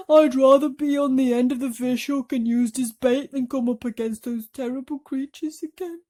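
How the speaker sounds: terrified earthworm. A narrator's reading. Earthworm shrieking to all about the creatures. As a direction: beg in fear.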